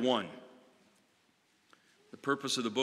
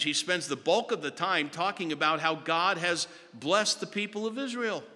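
ordinary talk, nothing marked

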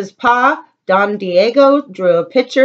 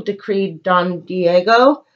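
usual speaking voice

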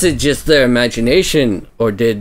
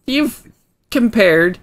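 high character voice; 14 semitones higher than usual. Acted. High traveler voice.

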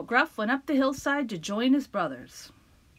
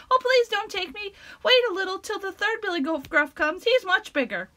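high, louder pleading goat; 9 semitones higher, 4 dB louder than usual. Acted. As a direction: beg in a high voice.

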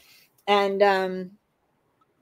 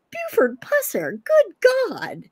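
incredulous; 10 semitones higher, slower than usual. Retold or imitated. Old man's incredulous reply, acted.

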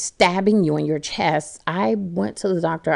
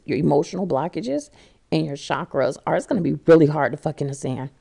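ordinary talk

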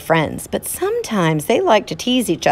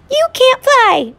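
very high, loud, slow twitter; shrill it out like a tiny bird; 5 dB louder than usual. Acted. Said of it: "twittered the bird".

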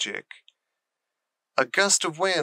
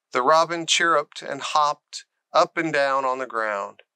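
ordinary delivery, nothing marked

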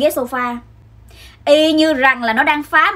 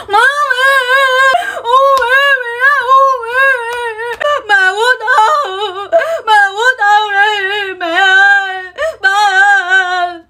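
hammed-up high wailing, long drawn-out words; khóc la ăn vạ; 8 semitones higher, 4 dB louder, much slower than usual. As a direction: bawl for parents like a tantruming child. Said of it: "Tôi nằm ra tôi khóc quá trời luôn". (gào khóc ăn vạ, giọng cao, kéo dài)

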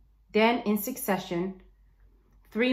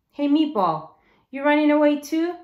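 higher, louder call across the room; 7 semitones higher, 6 dB louder than usual. Acted. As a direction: shout it at the stranger in the doorway. Boy calls out to stranger.

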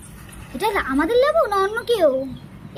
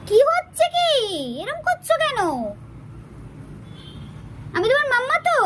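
much higher, cutesy scolding; 8 semitones higher than usual. Real, not acted. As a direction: chide the pet in a squeaky pet voice. Mother scolds pet in babytalk. (উঁচু আদুরে গলায় বকুনি)

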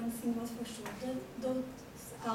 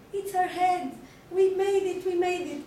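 triumphant shout; 6 semitones higher, 8 dB louder than usual. Acted. Killers cheering over the victim.

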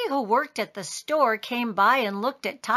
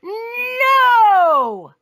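huge, high, long-drawn NO; all yelling; 11 semitones higher, 7 dB louder than usual. A narrator's reading. Father, Andrew and Julie refuse together, yelling. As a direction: stretch out a full yell. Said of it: "all yelled".

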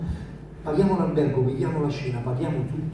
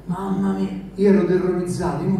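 ordinary talk

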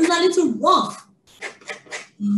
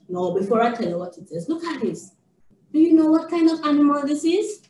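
normal talking voice

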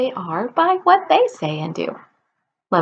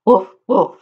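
dog bark; 4 dB louder than usual. Shown as a demonstration. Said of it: "because it says".

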